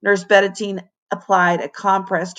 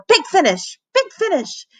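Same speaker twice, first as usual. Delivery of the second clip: high, urgent holler; 10 semitones higher than usual. A narrator's reading. Music teacher hollering at the class, high. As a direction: call the big finish out high. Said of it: "Mister Halfnote hollered".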